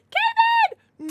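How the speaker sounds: very high, loud shout of the name; loud call. Real, not acted. Streamer calling out to Kevin, a very high shout. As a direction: yell the name across the room.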